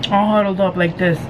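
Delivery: normal talking voice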